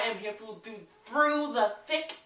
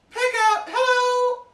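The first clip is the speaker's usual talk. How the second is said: excited squeal; 10 dB louder, slower than usual. Retold or imitated. Excited retelling of phone call.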